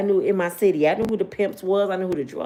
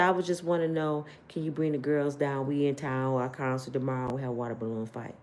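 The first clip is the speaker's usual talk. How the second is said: lower, softer smooth-talking voice; smooth singer; 6 semitones lower, 6 dB quieter than usual. Retold or imitated. Singer coaxing brother on phone, low and smooth. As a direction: sweet-talk him into bringing the girls.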